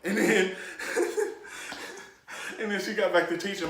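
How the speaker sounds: ordinary talk, nothing marked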